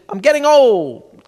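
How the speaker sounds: slow, loud, stretched-out whine; complaining old woman. Retold or imitated. Old woman after the show complaining to the comic, slow whine. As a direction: drag it out like a weary old lady. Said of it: "She said".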